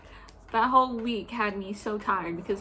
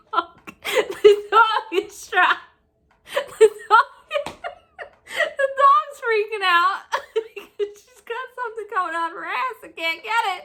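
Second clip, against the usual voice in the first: laughing through it; 8 semitones higher than usual. Real, not acted. Laughing while telling.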